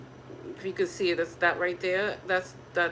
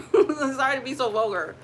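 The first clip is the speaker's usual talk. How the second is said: laughing apology; 4 semitones higher, 7 dB louder than usual. Real, not acted. To viewers after a crude joke, laughing. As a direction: apologize through a laugh, louder.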